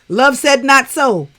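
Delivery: drawn-out, loud mock verdict on the push-up; voicing her body. Sister voicing her body's slow loud refusal. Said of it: "your body was like".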